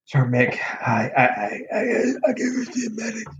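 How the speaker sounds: a lightly voiced sick kid who can barely speak; croaky sick kid. Sick young soldier begging his boss to see a medic, lightly croaked. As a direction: strain out the words like your throat is swollen. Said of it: "this is the way he's talking".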